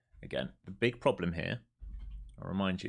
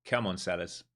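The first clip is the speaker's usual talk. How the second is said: usual speaking voice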